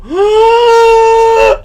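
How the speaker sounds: sharp high gasp of shock; dramatic gasp. Pretend. Pitching a sketch to his friend, a big shocked gasp. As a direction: gasp loud and high as if stunned. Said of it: "And then I go".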